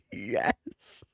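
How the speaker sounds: high, breathy, drawn-out yes; breathy triumph. Real, not acted. Player relieved at the vote result, breathy triumph. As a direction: celebrate in an excited half-whisper.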